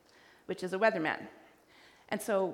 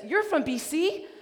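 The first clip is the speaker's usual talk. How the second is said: surprised; 7 semitones higher, 6 dB louder than usual. Retold or imitated. Quiet husband suddenly perks up.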